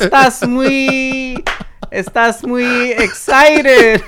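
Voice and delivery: retelling his clumsy Spanish on stage, laughing; laughing bad Spanish. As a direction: stretch out the broken Spanish while cracking up. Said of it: "I said".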